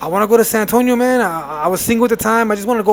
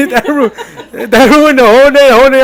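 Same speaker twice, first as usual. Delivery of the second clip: laughing, higher and louder; 6 semitones higher, 6 dB louder than usual. Real, not acted. Son laughing about the ruined day, louder. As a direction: laugh through the memory.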